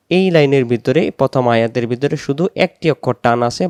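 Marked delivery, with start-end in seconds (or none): none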